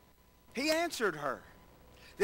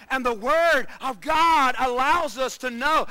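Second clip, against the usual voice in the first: preaching; 4 semitones higher, 9 dB louder than usual. Acted. Loud preaching line.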